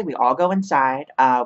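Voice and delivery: ordinary delivery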